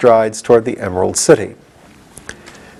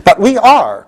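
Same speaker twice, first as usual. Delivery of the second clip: high, loud stamping insistence; impatient; 10 semitones higher, 4 dB louder, slower than usual. Acted. General Ginger stamps at the gatekeeper, high and impatient. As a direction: insist impatiently, hitting are. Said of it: "stamping her foot impatiently".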